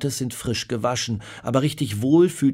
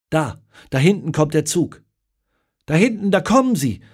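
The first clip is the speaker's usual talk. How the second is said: high, loud, eager cry toward the train; excited calling out; 5 semitones higher, 6 dB louder than usual. Acted. Woman on the platform points out the arriving train, excitedly calling. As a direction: call it out as the train comes into view.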